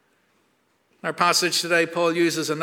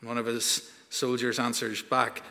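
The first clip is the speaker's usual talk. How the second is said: ordinary delivery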